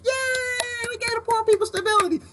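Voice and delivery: high squeaky sarcastic cheer, up high; mock cheer. Mocking a government brag in a high sarcastic cheer. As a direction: cheer it in a falsetto of fake joy.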